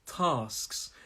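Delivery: TASKS as a slow "tahsks"; southern long "ah". Shown as a demonstration. Teacher repeats for learners, TASKS with southern long "ah". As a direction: model TASKS with an open back "ah". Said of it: "my pronunciation is quite southern".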